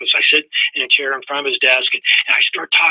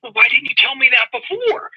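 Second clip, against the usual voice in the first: indignant question; 10 semitones higher than usual. Retold or imitated. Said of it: "I'm like".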